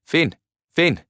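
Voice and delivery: high urgent calls of a name; acted searching shouts; calling out. A narrator's reading. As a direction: call again and again into wreckage. Searching wreck for friend.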